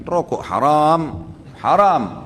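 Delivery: slow, forceful insistence on "haram"; emphatic haram. Real, not acted. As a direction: hammer the word "haram", stretching it. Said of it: "Sudah dijelaskan".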